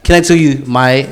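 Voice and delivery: normal talking voice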